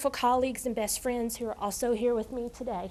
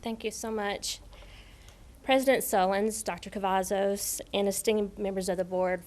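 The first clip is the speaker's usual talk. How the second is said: usual speaking voice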